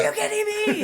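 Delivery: high squeaky mock outrage, laughing; mock disbelief. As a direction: yelp it high in mock shock. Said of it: "He's like".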